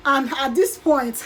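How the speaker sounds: ordinary delivery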